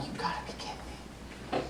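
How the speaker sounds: breathy disbelieving whisper; whispering under his breath. Retold or imitated. Seeing the cop step out of his car, whispered disbelief.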